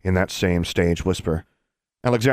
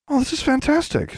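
put-on very high, gushing child character; squeaky-high young character; high youngster voice. A narrator's reading. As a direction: pipe it up high like a thrilled kid.

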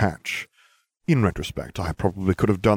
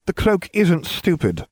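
slow, higher insistence; insistent; 11 semitones higher than usual. A narrator's reading. Harry defends his cloak to Hermione, insistent. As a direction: dig in and defend it. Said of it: "I insisted".

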